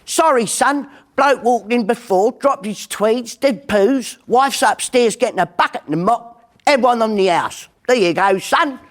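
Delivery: high, chatty English barman; barman's voice. Retold or imitated. Barman explains the mess to the bloke, high and chatty. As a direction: play the barman breaking the news. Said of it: "And the barman says".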